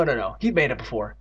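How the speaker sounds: plain reading voice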